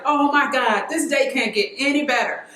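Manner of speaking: high-pitched, gushing fan thoughts; retold excitement. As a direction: re-enact being thrilled beyond belief. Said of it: "I'm so excited".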